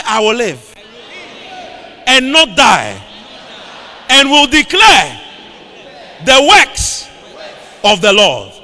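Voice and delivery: loud preacher's call-and-repeat; forceful, punched proclamation; rallying declaration. Real, not acted. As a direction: belt it out in short phrases for the congregation to echo. Leads congregation to repeat declaration.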